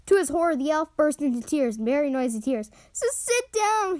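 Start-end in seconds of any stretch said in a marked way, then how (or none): 2.9-4.0 slow, high, tearful wail. A narrator's reading. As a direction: wail it through tears, drawn out. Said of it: "he wailed".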